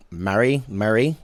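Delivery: tentative New Zealand accent, rising; attempted New Zealand. Shown as a demonstration. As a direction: feel out a Kiwi accent. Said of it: "they're New Zealanders".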